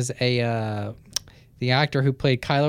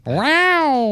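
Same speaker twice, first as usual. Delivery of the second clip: mock Yoshi; 12 semitones higher, 5 dB louder than usual. Yoshi voice begins.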